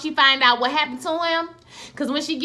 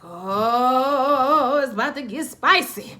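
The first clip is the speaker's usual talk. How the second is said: relishing anticipation; 2 semitones higher, much slower than usual. Retold or imitated. Acted viewer reaction to threat.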